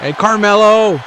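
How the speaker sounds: high, loud shout of the name; excited exclamation. Real, not acted. Commentator reacts to Carmelo in the match, excited. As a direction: cry out the wrestler's name at the big moment. Calling a big match moment.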